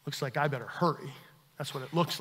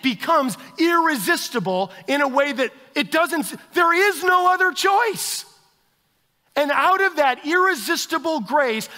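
louder, higher, driving sermon voice; full-force preaching; 9 semitones higher, 9 dB louder than usual. Acted. Pastor pressing irresistible grace on the congregation, fervent preaching.

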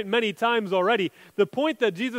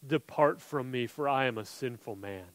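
softer, lower, humbled plea; humble quiet confession; 8 semitones lower, 10 dB quieter than usual. Retold or imitated. Peter confessing his sin to Jesus, hushed and humble. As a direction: murmur it like a man ashamed.

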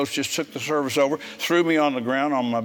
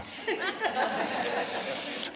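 airy high laugh; 9 dB quieter than usual. Real, not acted. Laughs at son's denial.

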